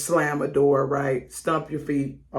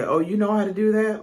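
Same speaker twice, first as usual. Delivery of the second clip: high, louder fake-surprised put-down; mock belittling; 7 semitones higher, 4 dB louder than usual. Coworker belittling you in a meeting, mock surprise. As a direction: act amazed to belittle a coworker. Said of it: "they'll try to belittle you".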